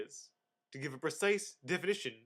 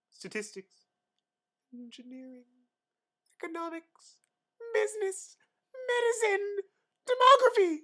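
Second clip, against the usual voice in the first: each item pitched higher, ending much higher and louder; climbing list; 8 dB louder than usual. Real, not acted.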